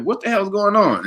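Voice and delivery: high-pitched retold bewilderment; bewildered demand. Man walking in on screaming roommate, bewildered. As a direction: ask it high, thoroughly confused.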